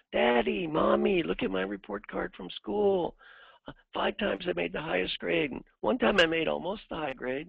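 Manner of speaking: put-on high, bright kid voice proudly showing a report card; eager child. Kid showing parents a report card, eager child voice. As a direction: lift the voice like an excited little kid.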